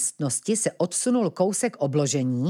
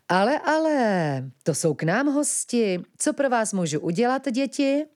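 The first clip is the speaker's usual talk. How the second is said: mock-welcoming villain; 7 semitones higher than usual. A narrator's reading. Platón receives the children at the door, mock-welcoming. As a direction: greet them like a slyly pleased host.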